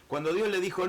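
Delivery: normal talking voice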